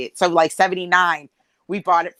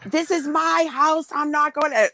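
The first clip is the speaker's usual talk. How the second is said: mother yelling; 10 semitones higher than usual. Retold or imitated. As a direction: shout it like an angry mom claiming her house. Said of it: "yell out all the time".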